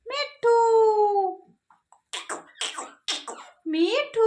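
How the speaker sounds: high Mithu with held ending; word demo for parrot. Shown as a demonstration. Teaching the parrot its name, high and drawn-out. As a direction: sing out Mithu high for a bird. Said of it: "बोलो".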